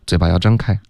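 ordinary delivery

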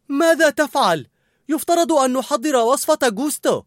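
high, loud telling-off; scolding. A narrator's reading. Cook to colleague straying from the recipe, scolding. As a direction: scold him at full pitch. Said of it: "نظرت إليه كوليت وعنفته".

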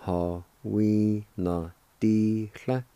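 Hawinadihla drawn out slowly, syllable by syllable; slow careful Hawinadihla; slow demo. Shown as a demonstration. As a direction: stretch out Hawinadihla for learners. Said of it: "It's pronounced like this".

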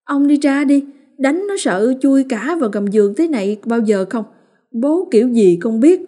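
high, loud accusing rant; still furious. A narrator's reading. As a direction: drive him away with scorn. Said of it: "vẫn chưa nguôi". (bà Liên đuổi chồng, vẫn giận)

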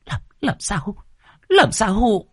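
high, stammering woman's voice, rising in volume; panicked. A narrator's reading. Woman caught off guard by the youth, panicked. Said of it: "Mụ hoảng hốt".

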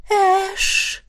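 put-on high breathy girl's voice calling his name; beckoning sigh. A narrator's reading. Izzy calls Ash invitingly, in a high sighing voice. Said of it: "призывно выдохнула Изи".

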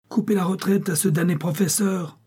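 high-pitched shouted order; calling out. A narrator's reading. Hollander ordering his companion to block the professor, shouting. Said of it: "cria Van Gogh".

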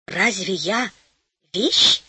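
mildly astonished question, lifting on the last word; a touch of wondering surprise; surprised. A narrator's reading. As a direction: ask it in gentle disbelief. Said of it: "удивилась красавица".

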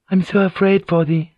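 understated, tender worry; mild fear. Acted. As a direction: confide a fear for a loved one. Frightened woman confides in lover.

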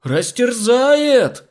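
very drawn-out, high, loud scream; top-note shriek. A narrator's reading. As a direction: hold a high note of panic like a siren. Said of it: "Легко взяла верхнее до Кока". (протяжный пронзительный вопль)

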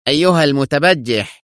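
indignant outburst; angry exclamation. A narrator's reading. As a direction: exclaim it in anger. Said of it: "وهو يهتف في غضب".

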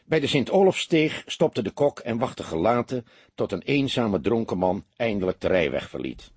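ordinary delivery

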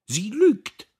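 very high, loud cry of protest; outraged. A narrator's reading. Sister defends her suitor at table, outraged. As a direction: cry out the accusation, stung. Accused sister retorts before sobbing.

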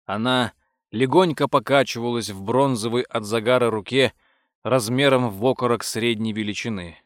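ordinary delivery

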